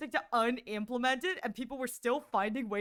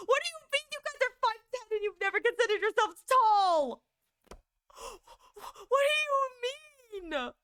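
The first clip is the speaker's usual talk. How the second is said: incredulous; 9 semitones higher than usual. Real, not acted. Disbelief at tall viewers.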